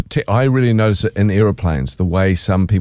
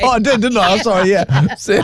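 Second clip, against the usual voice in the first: laughing apology; 11 semitones higher than usual. Real, not acted. Caught out, laughing.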